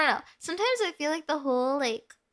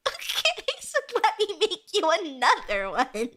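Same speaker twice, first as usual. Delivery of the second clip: laughing barista voice; 4 semitones higher, slower than usual. Retold or imitated. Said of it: "And I was like".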